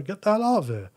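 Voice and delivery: a scornful, half-laughing get out of here; scoffing dismissal. Retold or imitated. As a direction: wave the idea away with a scoff. Mocking dismissal of bad reasoning.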